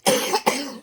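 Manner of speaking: a real dry cough from an itchy throat. Real, not acted. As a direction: cough hard once, then stop. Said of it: "Sorry about the coughing".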